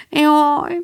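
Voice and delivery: high, longing cry of delight; Joe, thrilled by the Swiss school, exclaims; excited exclamation. Acted. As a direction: gush it out like an eager girl. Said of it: "kiáltott fel Joe".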